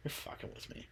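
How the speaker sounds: scoffing, breathy whisper; sleepy dad scoffing in a whisper. Retold or imitated. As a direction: brush it off under your breath. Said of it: "he's like".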